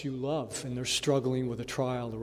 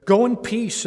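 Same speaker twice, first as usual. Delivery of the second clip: higher, louder blessing; emphatic blessing; 8 semitones higher, 9 dB louder than usual. Retold or imitated. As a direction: send her off with a ringing blessing. Eli blesses Hannah.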